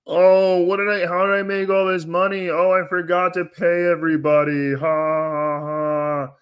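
hammed-up Ray Romano with a fake laugh; mock Ray Romano. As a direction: ham up a clueless rich comic. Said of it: "Brad Garrett used to tell a joke about Ray Romano".

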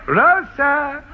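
put-on Italian accent, hollering for his daughter; calling out. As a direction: call her across the house.

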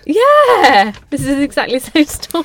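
high, loud, delighted yell; excited shout. Real, not acted. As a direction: burst out in amazement. Discovers they share a story.

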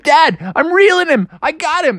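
put-on high, eager child voice; proud little frog calling to dad; excited Froggy. Froggy telling dad he has the fish, high excited. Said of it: "He's like".

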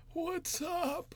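put-on high, thin frail elderly voice; old man voice. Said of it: "that old man voice".

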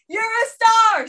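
high, loud, stretched-out praise; rousing cheer. Shown as a demonstration. Coach leads the class in praise, rousing cheer. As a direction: call it out slowly for everyone to echo. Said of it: "I want you to say it with me".

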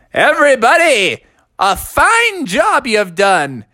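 stretched-out, proclaiming country uncle; Uncle Melvin/character. A narrator's reading. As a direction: announce praise with drawn-out relish. Uncle Melvin praises the family.

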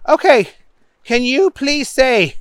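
high-pitched exasperated judge; upset judge. A narrator's reading. Upset judge instructing the defendant, high and exasperated. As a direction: ask with strained patience in a high voice. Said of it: "The judge was getting upset".

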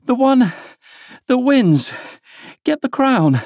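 high, panting, broken phrases; winded little question in bursts; out of breath. Acted. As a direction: gasp it out between breaths while running. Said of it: "putting her quite out of breath".

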